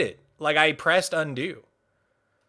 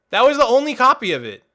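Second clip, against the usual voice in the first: frustrated rant; 5 semitones higher, 6 dB louder, much faster than usual. Real, not acted. Venting over deleted build.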